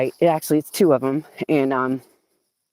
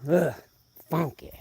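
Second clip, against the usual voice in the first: normal talking voice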